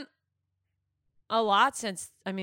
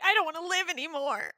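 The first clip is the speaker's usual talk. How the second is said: high-pitched comic whine; mock despair; 8 semitones higher than usual. Friend voices the son whose prank backfired, mock despairing. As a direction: play it mortified, voice up high.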